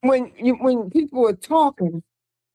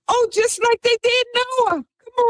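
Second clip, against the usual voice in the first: indignant exclaim; 12 semitones higher than usual. Real, not acted. Indignant aside to listeners.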